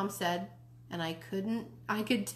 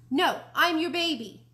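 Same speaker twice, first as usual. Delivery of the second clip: louder insistence; 8 semitones higher, 7 dB louder than usual. Acted. Jealous child insists to mom.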